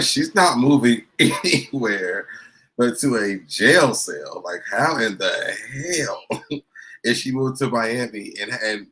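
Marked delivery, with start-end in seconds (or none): 0.0-4.4 louder and slow, laughing through it; laughing mock quote. Host scoffing about her move, laughing while quoting. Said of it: "[name] is like".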